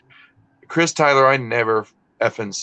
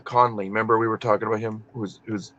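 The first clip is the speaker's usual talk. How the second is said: ordinary talk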